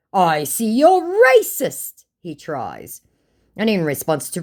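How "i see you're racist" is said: high, loud, drawn-out charge; exaggerated quoted scammer jab; mock accusation. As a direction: mimic his accusation slowly, pitched way up.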